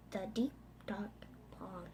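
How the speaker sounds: breathy storyteller whisper, drawing them in; hushed whisper. A narrator's reading. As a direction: whisper it, dark and spooky. Spooky bedtime story narration.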